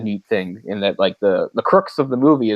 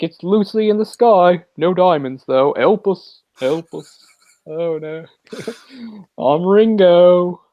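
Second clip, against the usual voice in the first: mock Liverpool accent; 7 semitones higher, 4 dB louder, slower than usual. Joking about Beatles accents, a high mock Liverpool accent. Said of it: "Sound like one of the Beatles".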